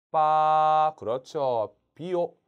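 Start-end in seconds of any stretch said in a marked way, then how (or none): 0.1-0.9 long level 빠, loud; first tone. Shown as a demonstration. Teacher putting A after B, 빠 sustained level. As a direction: hold 빠 on one even pitch. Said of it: "일성으로".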